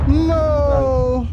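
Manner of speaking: high loud cry of dismay; shout. Real, not acted. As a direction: yell no in despair. Dying in firefight.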